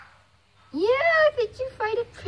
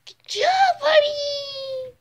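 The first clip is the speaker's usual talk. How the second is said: higher, louder happy praise; excited praise; 8 semitones higher, 5 dB louder, much slower than usual. Real, not acted. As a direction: cheer the pet on. Praising the cat loudly.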